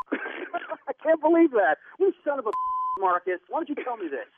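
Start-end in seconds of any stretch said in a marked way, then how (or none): none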